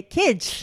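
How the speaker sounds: put-on "Kids" lisped slushily through both sides of the tongue, high and loud; slushy lisp on "Kids", high, drawn-out impersonation; double lateral lisp. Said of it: "he had a double lateral lisp".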